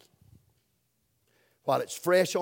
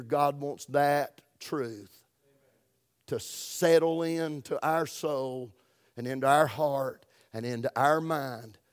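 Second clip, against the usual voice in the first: slow emphasis; slower than usual. Real, not acted. Preacher slowing to drive point.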